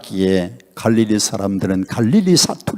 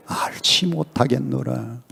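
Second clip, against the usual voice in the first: much softer, trailing reading; hushed denial; 9 dB quieter than usual. A narrator's reading. Preacher voicing Peter's denial before the servant girl, hushed. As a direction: let the denial sink to a hush.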